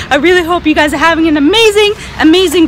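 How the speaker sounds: ordinary delivery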